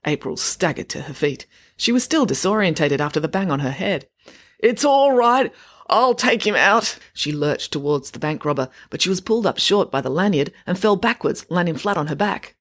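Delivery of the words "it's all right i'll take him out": dazed bravado. A narrator's reading. Dazed April, determined.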